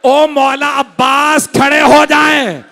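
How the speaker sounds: high, loud, stretched wail of appeal; anguished calling out. Acted. Preacher summoning Abbas to rise for Hussain, anguished shout. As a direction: call out slowly at full force.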